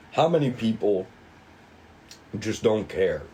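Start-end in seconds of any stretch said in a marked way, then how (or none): none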